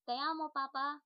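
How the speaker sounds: sweet little son saying it in Spanish; Spanish child's declaration. Shown as a demonstration. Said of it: "In Spanish".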